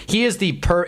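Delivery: ordinary talk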